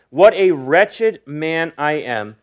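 slow, drawn-out quoted confession; slow quotation. Acted. Preacher quoting Paul's confession in verse twenty-four, slow and deliberate. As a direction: quote it slowly, weighting each word.

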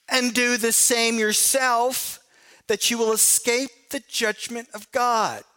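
rising, emphatic scripture reading with slowed phrases. Acted. Preacher reading Romans to the congregation, emphatic scripture voice. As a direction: read Paul's rebuke like a challenge to the room.

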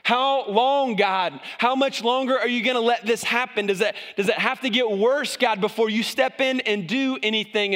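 high, urgent cry to God; pleading prophet. Acted. Habakkuk begging God to step in, high and urgent. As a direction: demand how long, pleading.

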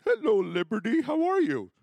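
high, loud squeaky pony greeting; toy pony voice. Pretend. Dad playing ponies with his daughter, high pony voice. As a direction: play a little plastic pony saying hello.

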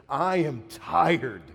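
high, loud, very slow cry of exhaustion; weary cry. Retold or imitated. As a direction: let the tired soul cry out. Weary soul cries out.

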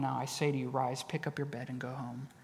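hushed tender command; quiet command. Retold or imitated. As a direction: say it gently but with full authority. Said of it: "he says to the paralytic".

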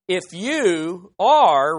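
are stretched long and slow; drawn-out stress. Shown as a demonstration. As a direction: lean on "are" and hold it. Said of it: "I keep saying that".

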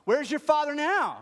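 high, loud taunting jeer; mocking soldier. Soldier jeering at Jesus on the cross, high and loud. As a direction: taunt him like a cruel soldier.

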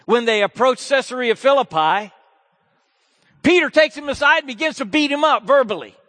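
high, loud preaching. Acted. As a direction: drive the story forward. Preaching narration.

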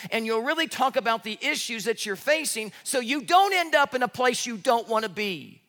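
loud, high, driving sermon voice; forceful preaching. Acted. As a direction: preach it hard to the congregation. Preacher at full force opening.

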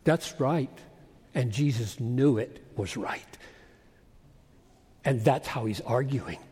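low, hushed aside to the congregation; quiet, low-pitched reflection; sober affirmation. Real, not acted. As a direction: drop low and soft to let it sink in. Preacher soberly affirming after quote.

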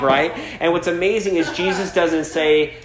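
usual speaking voice

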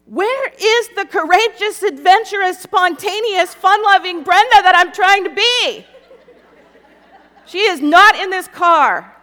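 loud, high, hammed-up wail at herself; exasperated outburst. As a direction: belt it out in comic self-exasperation. Mock outburst at her own fears.

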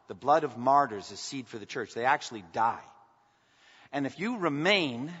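ordinary delivery

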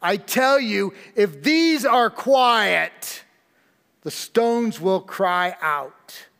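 a slow, swelling pronouncement; Jesus' solemn reply. Acted. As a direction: rise up and spell out each word with authority. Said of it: "And Jesus responds and says".

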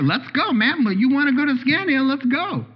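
bouncing with excitement to leave; eager grandpa. Acted. Grandpa rushing his grandson out the door, high and eager. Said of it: "grandpa voiced urging grandson".

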